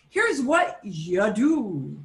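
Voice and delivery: "here's what you do" slowly stretched; drawn-out phrasing. Shown as a demonstration. Teacher introducing library rules to the student, drawn out. Rephrasing generic you as one.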